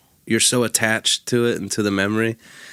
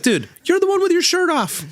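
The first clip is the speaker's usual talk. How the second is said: incredulous. Retold or imitated. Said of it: "And I'm like".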